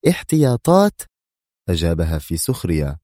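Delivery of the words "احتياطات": Sonia repeats the word warily; nervous caution. Acted. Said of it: "في حذر عصبي".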